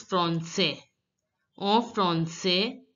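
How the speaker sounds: français as "fron-say". Shown as a demonstration. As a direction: end français on "say", s silent. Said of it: "A I is pronounced as".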